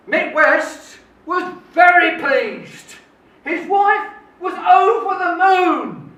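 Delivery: loud, drawn-out relish on each phrase; emphatic delight. Retold or imitated. Storyteller recalls the friend and wife's joy at the gift, emphatic delight.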